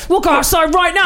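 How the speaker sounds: mock-shouting at a mate outside. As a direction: bellow it like a pub brawler.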